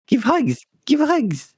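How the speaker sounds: very high squeaky pup voice; high giggly puppy asking for hugs; cute pup voice. Pretend. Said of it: "Be like".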